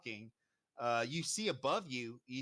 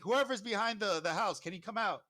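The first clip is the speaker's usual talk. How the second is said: raised, high-pitched guard's summons; calling out; 8 semitones higher, 4 dB louder than usual. Acted. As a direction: call to someone hiding behind a house. Guard calls to hidden person.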